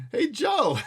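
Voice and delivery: loud, high call through laughter; laughing call. A narrator's reading. Attendant laughing as he calls to the driver. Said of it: "attendant called to the driver".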